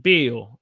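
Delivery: "Bill" drawn toward "beel"; Southern held vowel. Shown as a demonstration. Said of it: "the way we would say it".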